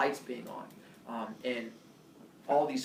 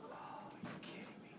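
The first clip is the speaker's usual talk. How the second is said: very quiet, voiceless muttered reaction; deflated disbelief; 20 dB quieter, much faster than usual. Retold or imitated. Student told the cops don't care, hushed disbelief. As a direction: let it out under your breath.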